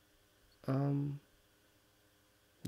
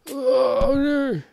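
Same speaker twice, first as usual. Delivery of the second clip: much higher, much louder cry of dismay; anguished shout; 11 dB louder than usual. Real, not acted. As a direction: howl it out as the run fails. Crashes on his last try.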